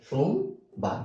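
সোমবার slowly split into সোম and বার; two separate beats. Shown as a demonstration. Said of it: "উচ্চারণ করছেন দুইবার".

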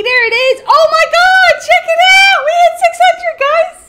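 very high, loud celebratory yell; real elated shout. Real, not acted. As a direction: shout the big news, beside yourself with joy. Celebrating reaching six hundred.

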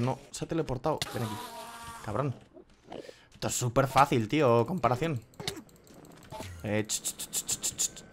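usual speaking voice

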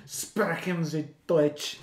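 slow, drawn-out mock German accent; exaggerated German accent. Shown as a demonstration. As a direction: put on a heavy German accent, slowly. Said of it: "which is speaking German".

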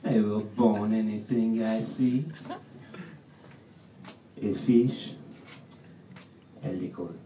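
loud, very slow menacing boast; menacing boning knife. Acted. Boning knife boasting of what it will bone, slow menace. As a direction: relish every threat slowly.

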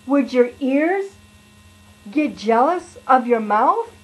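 normal talking voice

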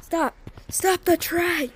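high-pitched panicked protest; panicked yelling. Real, not acted. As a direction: yell high in alarm to make it stop. Panicking at sudden game danger.

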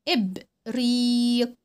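إبريق with the ee held long; slow drawn-out إبريق; stretched long ee. Shown as a demonstration. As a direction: hold the ee of إبريق. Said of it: "الحرف الممدود".

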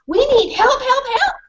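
high, rising yelps for help; frantic dog voice; urgent dog barking. Acted. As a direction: bark out for help.

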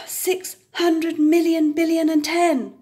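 tired out at the end of the count; exasperated sighing total; weary sigh. A narrator's reading. Sighing after endless counting.

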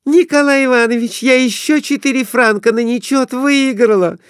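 high, thrilled squeal; wife calling husband to see her winnings; excited wife. A narrator's reading. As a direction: show off the win breathlessly. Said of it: "раздавался голос Глафиры".

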